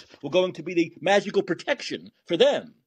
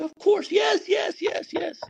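mock placating doctor. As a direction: gush agreement to calm them. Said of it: "Like doctors in a crazy house do".

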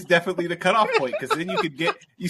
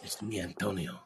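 low, soft mock Spanish accent; Antonio Banderas; 8 semitones lower, 8 dB quieter than usual. Introducing himself as a Spanish actor, low Spanish accent. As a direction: murmur it in a deep Spanish accent. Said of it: "It's like Antonio Banderas is Spanish".

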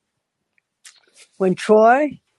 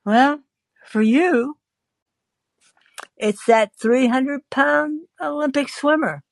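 nervous John; 6 semitones higher, slower than usual. Retold or imitated. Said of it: "he becomes frightened and he says".

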